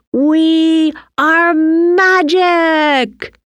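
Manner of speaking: very slow, high, stretched-out triumphant shout. A narrator's reading. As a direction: holler it skyward, stretching every word. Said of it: "Timmy shouted".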